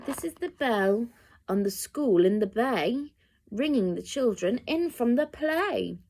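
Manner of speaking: ordinary delivery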